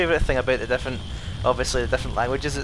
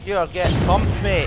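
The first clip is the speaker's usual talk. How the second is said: higher and louder, hitting each word; excited taunt; 5 semitones higher, 5 dB louder than usual. Real, not acted. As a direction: rub it in, fired up. Repeats taunt while fighting.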